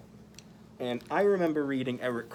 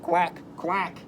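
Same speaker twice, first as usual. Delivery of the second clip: higher quacking call; duck quack; 4 semitones higher than usual. Acted. Voicing the duck in the story, quacking call. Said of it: "called the duck".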